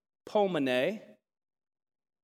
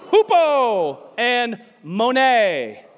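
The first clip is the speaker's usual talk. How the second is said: loud, slow, stretched-out words; high, hearty call-and-response shout; with gusto; 5 semitones higher, 9 dB louder, much slower than usual. Shown as a demonstration. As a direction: belt it out for the crowd to echo. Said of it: "say it with some gusto".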